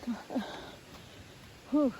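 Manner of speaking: winded while walking and talking; out of breath. Real, not acted. Vlogger climbing a slight slope while talking, out of breath. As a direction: puff lightly between phrases. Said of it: "सांस फूल जाता है बात करते करते".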